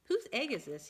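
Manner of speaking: put-on boy's question. Boy finding an egg on the path, lightly voiced and curious. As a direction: ask it like a puzzled little boy. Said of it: "he said".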